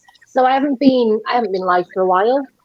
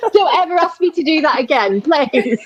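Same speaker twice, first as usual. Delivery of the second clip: higher, through real laughter; laughing; 7 semitones higher than usual. Real, not acted. Guest after attempting an accent on request, real laughing plea. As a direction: beg through the giggles.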